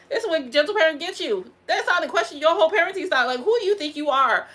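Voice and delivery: loud, fast rant. Real, not acted. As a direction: shout it heatedly.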